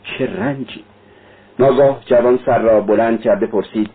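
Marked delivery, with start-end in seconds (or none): 0.0-0.8 softer, pained moan. Acted. Sonya grieving for him, a soft pained moan. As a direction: groan the words in sorrow. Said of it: "سونیا با ناله گفت".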